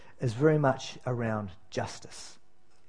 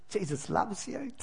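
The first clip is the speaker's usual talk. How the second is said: a higher, softer, sugary little Jesus loves you; mock sweet platitude; 5 semitones higher, 9 dB quieter than usual. Pastor mocking safe sermons, in a sweet higher voice. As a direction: play the safe new pastor saying only nice things. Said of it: "just saying nice things".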